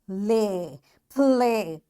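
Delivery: "play" sounded out, the long A stretched. Shown as a demonstration. Teacher shows pupils that A and Y make long A, stretched "play". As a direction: draw out the A in "play". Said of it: "It makes the long vowel sound".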